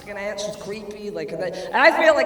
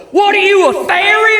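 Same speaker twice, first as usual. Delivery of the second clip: homophobe yell; 11 semitones higher, 8 dB louder than usual. Retold or imitated. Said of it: "and be like".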